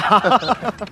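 high laugh, falling away; hearty laugh. Acted. Captain laughs at the villager who never asked, amused. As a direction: laugh out after the tease. Laughs after teasing reply.

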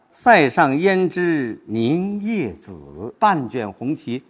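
slow, drawn-out recitation; pauses between scenes. Shown as a demonstration. Teacher showing pauses between scenes, slow recitation. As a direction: stretch the verse and let each scene settle. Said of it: "要注意停顿和转换".

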